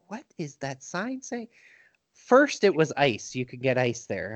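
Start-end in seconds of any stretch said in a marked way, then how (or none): 0.1-1.5 puzzled. Retold or imitated. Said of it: "I'm like".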